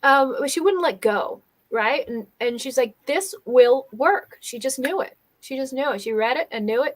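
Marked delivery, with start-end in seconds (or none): 3.1-4.4 "This will work" slowly, each word separately stressed; insistent, word by word. Retold or imitated. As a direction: imitate stubborn certainty, spacing out "This will work". Retelling her insistence it works.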